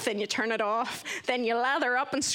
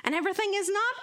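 mock-enthusiastic; 13 semitones higher than usual. Newcomer recalling thoughts on a weird place, mock-enthusiastic. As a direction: stretch and lift "awesome", mocking the positivity. Mocking relentless positivity of the place.

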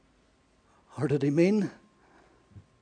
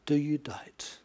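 inner voice; 4 dB quieter, slower than usual. Retold or imitated. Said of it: "and I thought".

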